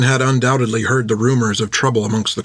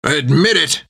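growling; 6 semitones higher, slower than usual. A narrator's reading. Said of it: "Jack growled back".

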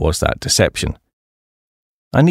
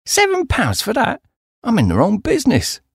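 high-pitched disbelieving Londoner; incredulous Duncan. Acted. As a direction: voice him up high, amazed at the price. Duncan shocked at honey price.

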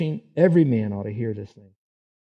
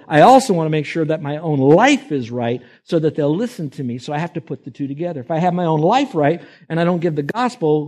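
louder preaching, pressing each line; full-force preaching; 4 semitones higher, 7 dB louder than usual. Acted. Preacher urging believers to live right, loud and fervent. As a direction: preach it out with conviction.

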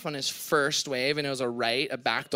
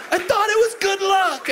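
high, louder mock shout of Andy's line; hammed-up yell; 6 dB louder than usual. Andy's line yelled up high and hammed. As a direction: belt it high and loud, half laughing.

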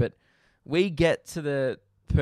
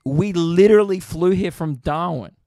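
louder, higher, incredulous "dude"; exasperated retort; 4 semitones higher, 9 dB louder than usual. Retold or imitated. To the airport officer, exasperated and loud. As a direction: hit it like you can't believe the question. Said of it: "It's like".

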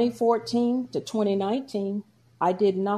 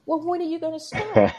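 much higher, teasing motherly push; mock mother; 10 semitones higher than usual. Mother pressing her to start exercising, high teasing voice. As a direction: poke her like a nagging mom. Voicing the mother's pushy question.

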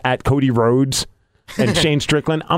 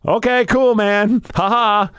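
uneasy laugh-off; 7 semitones higher, slower than usual. Retold or imitated. Nervously laughing off a warning.

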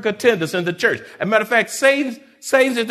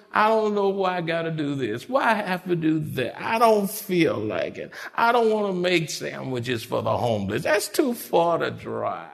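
mock whining; 3 semitones lower, 4 dB quieter than usual. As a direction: whine it like a sulky baby, softer, trailing into long wails. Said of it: "They whine".

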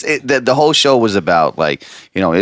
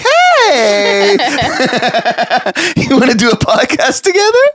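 high excited voice; 12 semitones higher than usual. Retold or imitated. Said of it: "she's like".

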